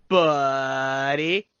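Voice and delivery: lightly voiced surfer dude drawl on one word; a quick nod to the nineties comedian gimmick voice; Pauly Shore weasel voice. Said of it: "that the weasel voice".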